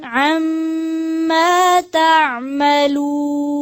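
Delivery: slow, melodic Quranic chanting; Quran recitation. Real, not acted. Teacher recites verses for students to follow, slow melodic chant. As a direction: draw out each verse in tajweed melody.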